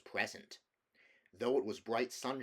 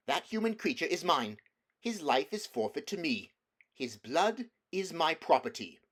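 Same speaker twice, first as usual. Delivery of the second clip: higher and louder, cold and greedy; a witch gloating over her due; 6 semitones higher, 7 dB louder than usual. A narrator's reading. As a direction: claim him as your prey. Witch claims Edmund.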